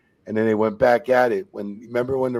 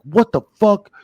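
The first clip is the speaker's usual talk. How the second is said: retold angry burst, higher and louder; getting hot; 12 semitones higher, 10 dB louder than usual. Wrestler reacting to hearing the other man's pay, getting hot. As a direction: imitate a wrestler getting riled up. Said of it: "he started getting hot".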